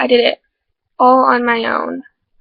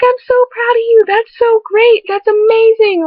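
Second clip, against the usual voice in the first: high, loud gushing praise; excited praise; 11 semitones higher, 6 dB louder than usual. Retold or imitated. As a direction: gush like a proud friend. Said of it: "She's like".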